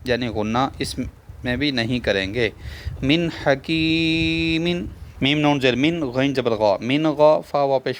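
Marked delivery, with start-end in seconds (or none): 3.0-4.7 من حکیم drawn out slowly and higher, the نون said clearly without ghunna; slow recitation. Shown as a demonstration. Teacher showing students izhar with a slow recitation. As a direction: recite من حکیم slowly, each sound held. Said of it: "تو ادھر بھی اظہار ہے".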